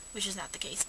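whispered aside. Real, not acted. Aside admitting exaggeration.

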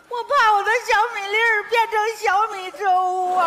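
hammed-up sobbing wail; comic crying lament; mock wailing. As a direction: wail it like a heartbroken mother, half laughing. Mother weeping about her daughter.

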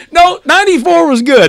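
high, laughing through words. Real, not acted. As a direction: say it while laughing. Laughing at running joke.

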